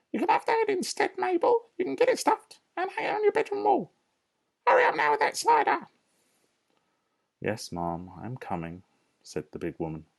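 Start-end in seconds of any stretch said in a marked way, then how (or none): 0.1-5.9 high, shrill-free bossy lady voice, much higher; Mrs Bean. Acted. Farmer's wife calling down from upstairs, bossy and high. 7.4-8.8 meek servant. Acted. Mabel answers meekly.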